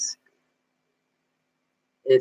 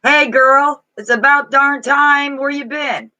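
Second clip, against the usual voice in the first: put-on much higher and louder, a breezy old friend; channeled spirit voice; 9 semitones higher, 4 dB louder, slower than usual. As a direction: greet her like a long-lost buddy from beyond. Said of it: "Did he talk like that?".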